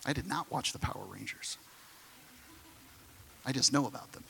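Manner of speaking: a hushed joke to the congregation; quiet aside. Real, not acted. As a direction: drop the voice for a sheepish aside. Aside after the outburst.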